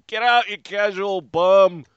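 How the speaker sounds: half-hearted Southie Boston heckle; failed Southie accent. Pretend. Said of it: "I can't do a Southie accent".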